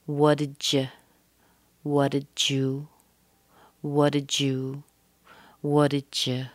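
"did you" fused into a didja/didju blend; connected J sound. Shown as a demonstration. Teacher drills D plus Y, making J in "What did you". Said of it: "we could connect these sounds".

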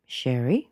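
SHERRY with the pitch lifting at the end; casual rising intonation. Shown as a demonstration. Answering what's your name, casual upward intonation. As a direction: let "Sherry" float up lightly, unfinished. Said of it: "It goes up".

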